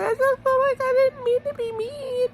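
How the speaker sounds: mock crying, very high whine; Teresa. Recapper mimicking a contestant having a fit, high mock wail. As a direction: imitate a tearful fit in a squeaky high voice. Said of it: "was crying".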